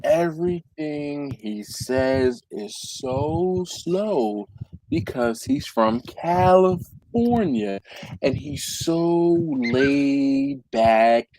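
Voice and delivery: slow, drawn-out imitation of a mellow Californian; slow laid-back talk. Mocking the drifter's laid-back pace, slow and stretched. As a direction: let the words drag lazily. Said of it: "he talks just like this".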